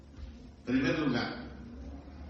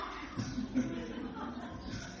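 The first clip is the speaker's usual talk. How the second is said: laughing; 9 semitones higher, 11 dB quieter than usual. Real, not acted. Laughs after mock scream.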